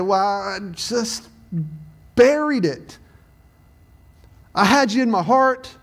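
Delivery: put-on high-pitched Southern accent, halting start. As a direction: play a sheepish excuse in a Southern accent. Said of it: "I said that so Southern".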